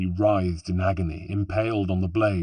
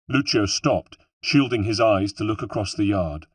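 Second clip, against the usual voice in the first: ordinary delivery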